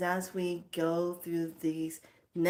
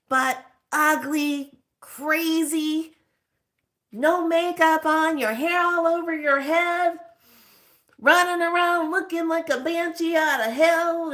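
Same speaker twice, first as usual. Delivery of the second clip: loud, high, drawn-out mock outrage; hammed-up ranting; 9 semitones higher, 9 dB louder than usual. Woman comically listing how wild you can look, hammed-up ranting. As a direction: belt out each wild detail, pitched high.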